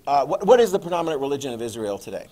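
ordinary talk

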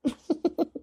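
soft, gloating little laugh. Acted. Woman pleased at rival's jealousy, soft chuckle. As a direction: chuckle quietly to yourself.

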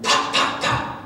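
sharp, voiceless popping noises; imitated gunshots. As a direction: imitate shots with percussive pops. Imitating gunshots in retold story.

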